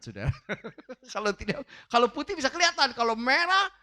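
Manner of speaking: ordinary talk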